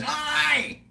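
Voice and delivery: high, shouted impression of an irate local yelling from his boat; a mock-outraged fisherman bellowing at thieves; angry boat guy. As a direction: play the furious bloke on the boat, yelling across the water. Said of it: "some guy goes past on his boat and goes".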